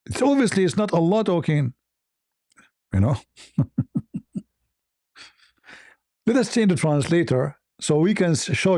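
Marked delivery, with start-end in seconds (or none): 3.6-4.4 short staccato chuckle; dry little laugh at the absurdity; mocking chuckle. Real, not acted. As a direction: chuckle in derision. Mocks the translation he reads.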